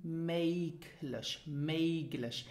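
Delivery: "möglich" with a hard g; common learner mistake. Retold or imitated. Teacher imitating students' mistake, hard-g mispronunciation. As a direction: say "möglich" as MAYG-lish, hard g before the l. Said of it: "I hear most of my students saying".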